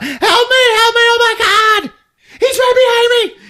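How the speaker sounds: very high, loud shrieks for help; hammed-up fleeing victim; mock panicked screaming. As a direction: squeal it out in frantic mock terror.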